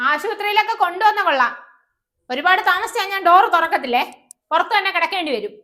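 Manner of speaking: wife threatening to lock the door; irritated warning. Acted. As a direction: scold him as he leaves. Wife warning husband crossly.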